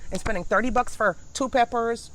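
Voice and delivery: ordinary talk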